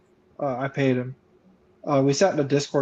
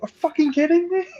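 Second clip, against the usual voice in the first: 1 incredulous; 12 semitones higher, 3 dB louder than usual. Retold or imitated. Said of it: "I would just be like".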